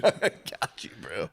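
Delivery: secret whisper between accomplices. Retold or imitated. Two secret night killers whispering to each other. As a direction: breathe it like a conspirator.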